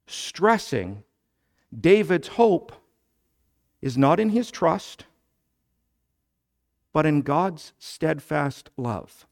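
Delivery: ordinary delivery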